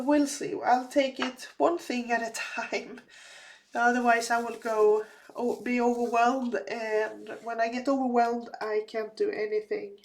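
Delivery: ordinary delivery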